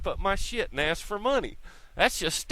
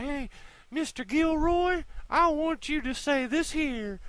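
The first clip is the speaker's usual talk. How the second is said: pleading client; 13 semitones higher than usual. Retold or imitated. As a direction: play the meek client asking for a favour, much higher. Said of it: "he can tap you on the shoulder and say".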